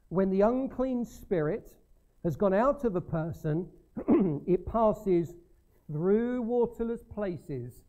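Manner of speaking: loud, high scripture reading. Acted. Preacher reading Luke to the church, loud and high. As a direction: proclaim the verse to the room.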